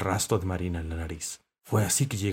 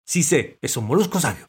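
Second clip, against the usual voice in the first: insistent, high and loud; determined; 6 semitones higher, 6 dB louder than usual. A narrator's reading. Dog insisting he knows the mollusc, determined. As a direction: stand your ground firmly. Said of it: "dijo Galleta con determinación".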